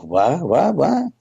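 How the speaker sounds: stammered astonished what; incredulous what. Retold or imitated. Stunned by dad's news.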